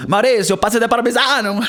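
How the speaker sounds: loud, high-pitched cartoon Italian accent; hammed-up Italian accent. Mocking the film's accents, hammed-up Italian accent. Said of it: "This is not how they speak in the Tuscany".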